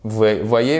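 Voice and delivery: ordinary talk, nothing marked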